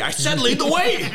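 very high mock-exasperated deer order; insistent talking deer. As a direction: insist in a squeaked-up comic voice. Same deer repeats order, more insistent.

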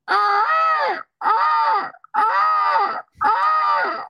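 very high, loud screeching peacock cries; peacock call. Retold or imitated. Kid imitating the neighbor's peacock, piercing high loud screech. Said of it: "a peacock goes".

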